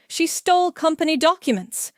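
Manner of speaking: high, loud accusation; shouting. A narrator's reading. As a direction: shout the accusation across the office.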